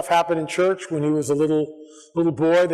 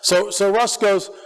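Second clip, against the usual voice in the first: normal talking voice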